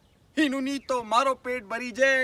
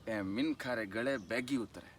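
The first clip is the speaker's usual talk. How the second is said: very low, soft stage voice; hushed aside; 12 semitones lower, 13 dB quieter than usual. Acted. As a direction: drop right down, confiding. Shepherd's bitter point to the cleric. (धीमी, गहरी आवाज़ में)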